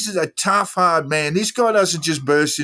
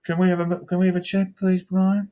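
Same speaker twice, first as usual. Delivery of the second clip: higher, sugary imitation of a false friend; mocking sweetness; 6 semitones higher than usual. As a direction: mimic a phony polite plea. Mocking Flannery's sweet request.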